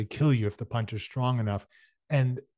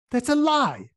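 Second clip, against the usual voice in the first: much higher, louder shout; 12 semitones higher, 6 dB louder than usual. Acted. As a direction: fling the denial out loud. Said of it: "shouted Ernie".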